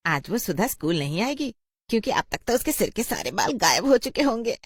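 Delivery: high, mocking voice breaking into laughter; gleeful mockery, rising in pitch; gloating schoolgirl. A girl jeers that Sudha will skip school, gleeful mockery.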